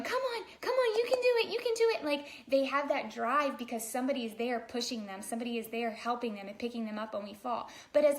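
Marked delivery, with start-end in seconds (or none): 0.0-2.0 cheering parent. Retold or imitated. Parent urging baby to walk, high excited cheering. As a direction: squeal encouragement at a wobbling toddler. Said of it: "somebody standing in front of them going".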